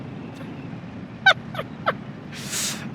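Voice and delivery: high airy laugh; breathy laugh. Real, not acted. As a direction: laugh under your breath. Laughs at own slip.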